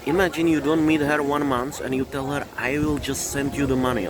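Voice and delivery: usual speaking voice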